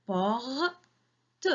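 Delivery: "Porte" as two separate beats, "por", pause, "te"; split into syllables. Shown as a demonstration. Teacher counts syllables with children, syllable by syllable. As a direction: break "Porte" apart, sounding the final "te" alone. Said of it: "il y a deux syllabes".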